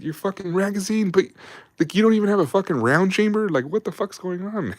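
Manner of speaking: mock chewing out. Sergeants scolding Johnson about the empty chamber, mock exasperation. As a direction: chew him out in disbelief. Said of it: "they're like".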